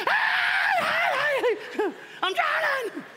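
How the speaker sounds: high, loud hammed-up yell; mock cry for help. Preacher urging the congregation to shout for help, playful high yell. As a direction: ham up a cry for help, laughing. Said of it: "be like".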